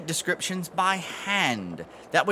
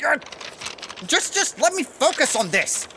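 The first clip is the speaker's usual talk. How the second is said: flustered, high; 9 semitones higher than usual. Acted. Flustered under pressure.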